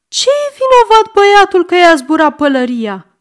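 indignant grandmother. A narrator's reading. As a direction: defend the boy to the conductor. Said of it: "zice mama mare". (bunica indignată îl apără pe băiat, mult mai sus și mai tare)

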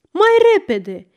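loud, high-pitched urging; urgent shout. A narrator's reading. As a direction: call out to hurry the others on. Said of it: "strigă el la ceilalți".

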